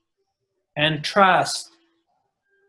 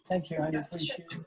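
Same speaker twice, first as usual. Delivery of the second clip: quiet aside; 15 dB quieter, much faster than usual. Real, not acted. Aside to partner off mic.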